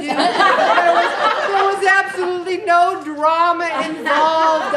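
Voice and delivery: loud, high insistence with a laugh; emphatic laughing outburst. Real, not acted. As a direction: laugh it out loud: no drama at all.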